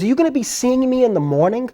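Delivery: high-pitched imitation of a clear-voiced woman; imitated polite caller. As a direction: mimic a polite caller from the past, pitched well up. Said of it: "the most clearest voice".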